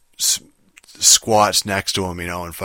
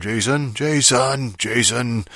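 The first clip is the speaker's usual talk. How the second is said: repeated call; 5 semitones higher, much slower than usual. Retold or imitated. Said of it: "him walking around".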